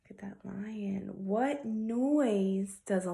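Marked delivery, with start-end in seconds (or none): 0.1-1.1 whispering. Acted. Whisper to children.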